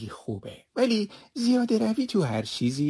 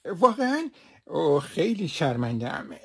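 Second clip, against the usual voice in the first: plain reading voice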